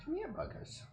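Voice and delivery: very high, very soft coo to a pet; high hushed pet voice, meows included. Real, not acted. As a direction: sweet-talk the cat in a tiny high voice. Calling the cats.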